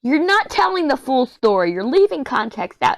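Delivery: high-pitched indignant protest, retold; exasperated protest. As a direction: squeal it out like you've been wronged. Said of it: "I go".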